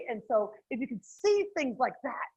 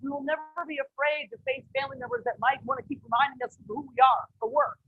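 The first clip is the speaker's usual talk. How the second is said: normal talking voice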